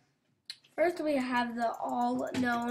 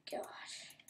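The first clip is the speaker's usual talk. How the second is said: whisper; 16 dB quieter than usual. Real, not acted. Whispered after the scare.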